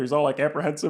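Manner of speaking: ordinary delivery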